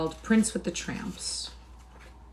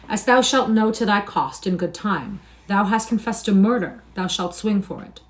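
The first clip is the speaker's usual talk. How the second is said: regal threat; 4 semitones higher, 8 dB louder than usual. A narrator's reading. King threatens a murderer.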